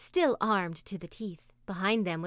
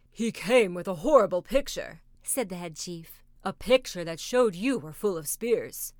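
head chief, grave; 2 semitones lower, 5 dB louder than usual. A narrator's reading.

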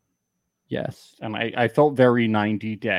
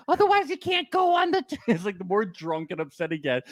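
usual speaking voice

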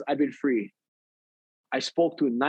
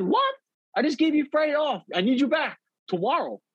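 high outraged what, then insistent; retold boss, high and indignant; shocked boss; 10 semitones higher than usual. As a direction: boss yelping in disbelief, pitched way up.

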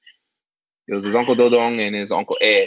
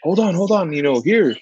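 much higher, eager call; uncle stopping him; 8 semitones higher than usual. Retold or imitated. Uncle halts the guest to hand over food, eager high call. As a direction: stop him at the door with gifts. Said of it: "he goes".

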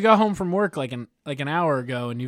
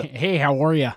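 bad Canadian accent; 4 dB louder than usual. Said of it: "It was a really bad Canadian accent".

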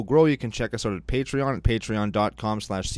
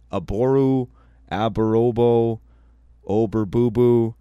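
louder and louder fumbled name; fumbling name, louder each try; 6 dB louder, slower than usual. Real, not acted. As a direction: mangle the name, getting louder. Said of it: "it's getting funnier and funnier".

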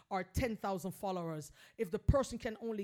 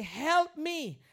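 a raised cry of pain, pleading; agony cry; 6 semitones higher, 6 dB louder than usual. Shown as a demonstration. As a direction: call out for help like it hurts. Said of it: "Make that agony cry and say".

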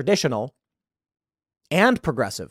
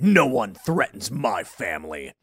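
mock action hero; 9 semitones lower, 3 dB quieter than usual. Manly man voiced in fantasy.

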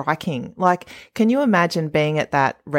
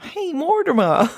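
much higher, put-on voice of a seventy-year-old man; mock old man voice; 13 semitones higher than usual. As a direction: ham up [name]'s old-man impression. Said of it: "can you do the accent?".

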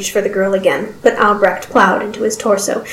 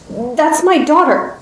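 higher, slow, urgent explanation; drawn-out, higher-pitched appeal; earnest explaining; 7 semitones higher, slower than usual. Acted. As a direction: try hard to make them understand. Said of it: "Albrecht tried to explain".

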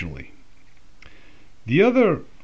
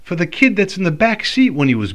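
wife's accusation; 11 semitones higher, 5 dB louder than usual. Retold or imitated. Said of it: "She's like".

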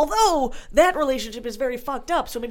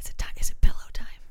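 whisper; faster than usual. Acted. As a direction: murmur it sleepily. Sleepy whispered bedtime bit.

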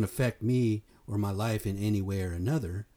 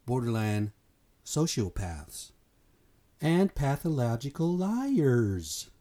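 slow emphasis; slower than usual. Real, not acted.

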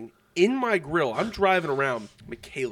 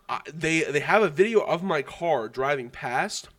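ordinary talk, nothing marked

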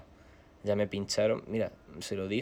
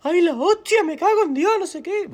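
aunt shrieking in shock; 11 dB louder than usual. Retold or imitated. Aunt shrieks at the cut hand.

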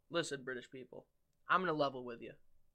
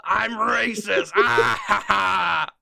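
loud, slow shout into a villainous laugh; mock villain confession; 5 semitones higher, 16 dB louder, much slower than usual. Friend fakes a damning clip, mock villain confession. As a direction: bellow it, then a big fake cackle.

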